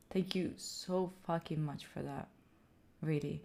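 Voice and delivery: very soft, low gratitude; hushed, moved thanks. Real, not acted. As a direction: let the thanks sink almost to a murmur. Repeats thanks, more hushed.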